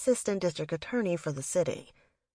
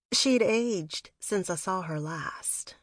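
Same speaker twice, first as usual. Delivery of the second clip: usual speaking voice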